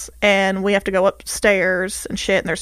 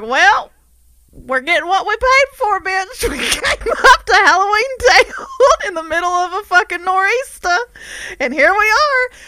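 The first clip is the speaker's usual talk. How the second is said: excited laughing rant; 9 semitones higher, 4 dB louder than usual. Retold or imitated. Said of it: "I was like".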